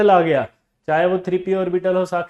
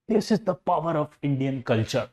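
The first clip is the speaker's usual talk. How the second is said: 1 mock solemn; 2 semitones lower, much slower than usual. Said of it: "mock solemn aside".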